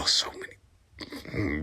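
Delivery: a soft unvoiced whisper to herself; whispered aside. Real, not acted. Reader whispering a comment to herself during the reading. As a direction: breathe it out as a secret aside.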